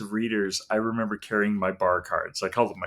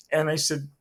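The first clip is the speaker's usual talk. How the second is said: ordinary talk, nothing marked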